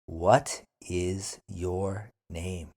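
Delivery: drawn-out What is your name?; slow repeat. Shown as a demonstration. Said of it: "then repeat slowly".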